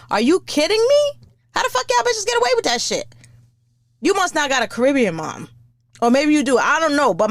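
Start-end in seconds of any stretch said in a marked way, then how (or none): 0.1-3.1 high, fast, incredulous outburst; high-pitched, fast, stunned rant; outraged disbelief. Real, not acted. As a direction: squeal it in total disbelief. Outraged disbelief at lazy moms.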